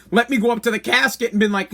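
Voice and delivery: ordinary delivery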